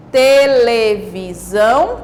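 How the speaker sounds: TELEVISÃO stretched as te, le, vi, são; syllable by syllable. Shown as a demonstration. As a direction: give TELEVISÃO four drawn-out beats. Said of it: "quatro sílabas".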